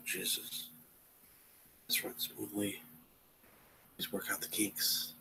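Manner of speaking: very soft, half-voiced aside; barely audible mutter; muttering to self. Real, not acted. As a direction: grumble a plea under your breath. Muttering at a stalling stream.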